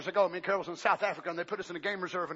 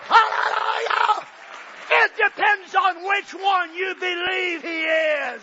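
shouted, high, slow preaching; full-force preaching; 14 dB louder, much slower than usual. Acted. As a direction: lift the congregation with a shout.

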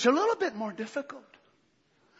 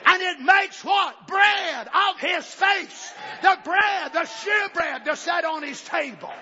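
loud, higher shouted climax, slowing at the end; full-force preaching; 8 semitones higher, 4 dB louder than usual. Acted. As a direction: build the sermon to a shout.

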